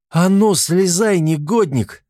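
high, loud, slow furious yell; angry shout. A narrator's reading. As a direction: scream at him to come down. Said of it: "закричала рассерженная Агнеса". (сердитый крик, громко и высоко)

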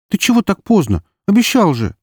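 put-on high, scolding young sister voice; reproachful sister. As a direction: scold him for coming home late. Sister scolds late brother.

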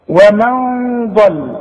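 ordinary talk

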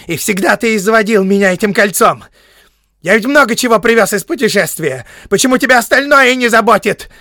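harsh, squealing high complaint; loud, high-pitched exasperated tirade; shrill annoyance. A narrator's reading. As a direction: shout it in a vexed, screechy voice. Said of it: "резкие и визгливые нотки пополам с досадой".

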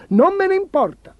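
very high, spoilt royal tantrum; high-pitched childish defiance from an emperor; petulant outburst. A narrator's reading. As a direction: throw the objection back, high and insistent. Spoilt emperor dismisses the protest.

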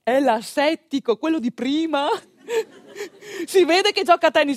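normal talking voice

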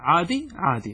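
normal talking voice

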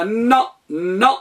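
الن، ن starting straight on the n sound, no glottal onset; nun without hamza. Shown as a demonstration. Teacher showing the letter nun alone, said without hamza. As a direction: say ن as a bare, slow nun with no hamza before it. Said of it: "النون وحدها بدون همزة".

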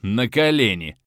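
lightly mimicking the count's order; mocking imitation. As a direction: parody the arrogant command. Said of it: "Нет, ты видел, гад, а?".

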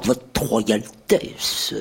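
bow low, stretch "royaltesse"; royaltesse, slow bow. A narrator's reading. Chancellor bowing to Bruno, slow "royaltesse". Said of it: "répondit le chancelier en s'inclinant très bas".

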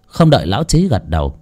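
plain reading voice

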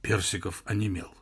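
usual speaking voice

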